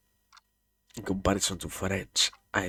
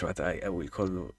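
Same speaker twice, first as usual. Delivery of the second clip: ordinary delivery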